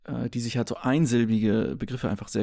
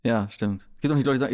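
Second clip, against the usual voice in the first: ordinary delivery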